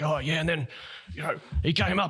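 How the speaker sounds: high, animated retelling voice; interviewed crocodile attack survivor. Retold or imitated. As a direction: imitate an excited local telling his story. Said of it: "he was just like".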